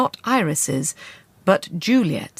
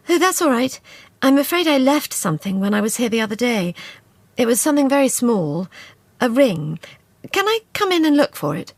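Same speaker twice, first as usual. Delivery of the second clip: higher, bright, wheedling voice; coaxing young woman; 6 semitones higher, 3 dB louder than usual. A narrator's reading. Juliet coaxing Dodds to let her in. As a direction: talk the maid round sweetly.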